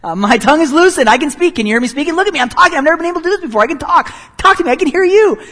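high, loud, triumphant yelling; loud victorious shout. Pretend. Healed man shouting to the crowd, victorious and loud. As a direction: burst out shouting with joy. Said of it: "You would probably respond in a loud, victorious sort of".

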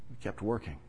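soft echo. Acted. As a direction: let the repeat fade to a murmur. Repeats line, echo much softer.

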